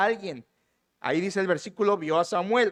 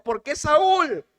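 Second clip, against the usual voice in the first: higher and louder, stretched slow cry of alarm; frightened cry; 6 semitones higher, 4 dB louder, much slower than usual. Retold or imitated. The medium crying out to Saúl in fright, a mock scared cry. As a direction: voice the medium's startled scream of fear. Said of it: "Ella grita de miedo".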